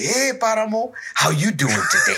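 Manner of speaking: high, laughing call out to Paramo; mock villager greeting, high and bright with laughter. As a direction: hail the elephant with a high laughing shout.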